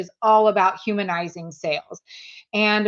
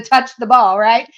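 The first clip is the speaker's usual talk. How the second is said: mock screaming mom; 3 semitones higher, 5 dB louder than usual. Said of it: "most competitive mom out there".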